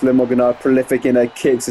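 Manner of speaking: normal talking voice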